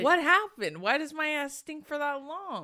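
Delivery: shocked. Real, not acted. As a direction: react as if it's outrageous. Reacting to the would-you-rather question.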